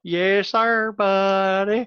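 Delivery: teasing Southern twang; Southern accent. Pretend. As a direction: play the good ol' boy in a Southern accent. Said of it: "when you did a Southern accent".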